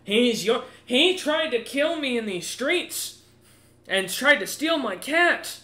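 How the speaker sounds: high, loud, drawn-out noble's outrage; accusing noble. Acted. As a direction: cry out your accusation as a wronged noble. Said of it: "looks at James immediately at that comment and goes".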